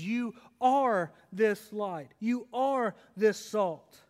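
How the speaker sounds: slow, each "are" weighted; slow emphatic quote. Shown as a demonstration. Preacher slowly quoting Jesus to believers. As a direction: spell it out slowly, stressing "are". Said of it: "that's why Jesus says".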